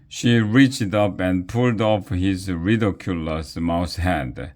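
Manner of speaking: ordinary delivery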